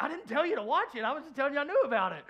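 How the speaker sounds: high, loud, fast protest; squeaky mock self-defence. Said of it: "I was like".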